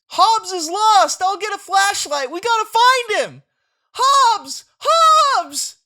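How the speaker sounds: high, loud frantic yelling; panicked shouting. Acted. Child frantic over his lost tiger, loud panicked shouts. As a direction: call out desperately into the night.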